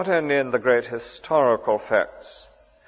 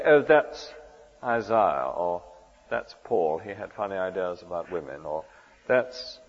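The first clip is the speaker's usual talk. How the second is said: lightly mocked dismissal, lower and softer; dismissive; 5 semitones lower, 5 dB quieter than usual. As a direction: brush them off quietly, imitating a condescending modern reader. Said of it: "We should never talk like that".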